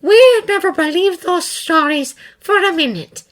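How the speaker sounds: stern. A narrator's reading. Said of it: "she says to him sternly".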